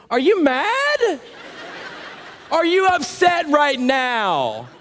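put-on teasing baby voice to the angry driver; mock sweet taunt.

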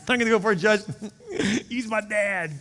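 loud, high, driving preacher voice; fervent preaching. Acted. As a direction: preach it out to the whole church. Preaching at full force.

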